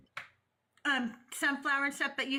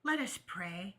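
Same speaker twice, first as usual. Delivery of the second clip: Sunday school teacher voice; 7 semitones lower than usual. Acted. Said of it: "I was using my Sunday school teacher voice".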